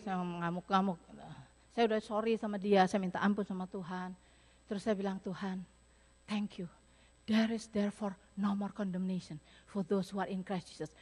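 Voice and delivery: low, soft, tender prayer voice; hushed prayerful retelling. Retold or imitated. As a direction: speak it like a humble prayer. Quiet reverent retold prayer. (doa yang lirih dan rendah hati)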